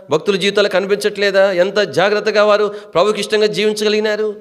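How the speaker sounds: high-pitched, insistent rhetorical questions; fervent preaching. Real, not acted. Preacher challenging listeners not to waste their days, fervently.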